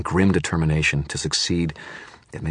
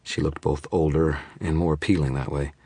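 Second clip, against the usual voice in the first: usual speaking voice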